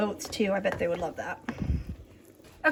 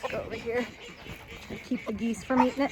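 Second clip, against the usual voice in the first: ordinary talk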